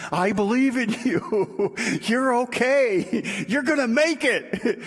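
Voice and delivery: high, slow, laughing self-encouragement; mirror pep talk. Pretend. Talking to yourself in the mirror, high and laughing. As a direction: cheer yourself on while laughing. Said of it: "you look in the mirror and you say".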